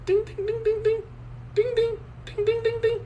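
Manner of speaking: very high sung bell dings. Acted. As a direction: ring it like a little bell. Sound effect bell.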